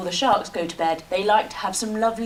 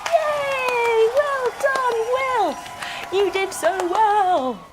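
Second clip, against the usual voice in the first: high, excited cheering praise; 12 semitones higher, 3 dB louder, much slower than usual. Pretend. Teacher praises little whale Wilf, high and cheering. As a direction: celebrate him like a proud teacher. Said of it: "give him a big round of applause".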